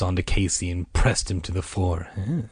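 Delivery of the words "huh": ordinary delivery, nothing marked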